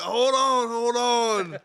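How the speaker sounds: loud, high hold on; calling out. Retold or imitated. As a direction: shout for a pause in the fight. Hit in forehead during BB fight.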